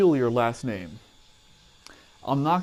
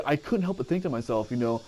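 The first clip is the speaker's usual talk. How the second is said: normal talking voice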